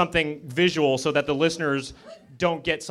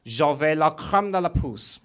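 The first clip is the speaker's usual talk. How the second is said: slow, put-on French; mock French accent. Shown as a demonstration. Comic demonstrating French to the crowd, slow mock French accent. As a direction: draw it out in fake French. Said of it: "how I say vagina in French".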